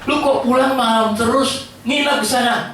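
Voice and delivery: mock scolding mum; nagging parent. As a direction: nag the child with irritation.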